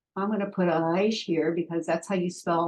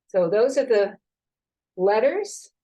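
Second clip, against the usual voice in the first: usual speaking voice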